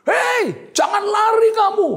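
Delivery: furious creditor yelling to stop a fleeing man; angry shout. Retold or imitated. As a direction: shout after someone running away. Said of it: "dia berteriak cukup kencang".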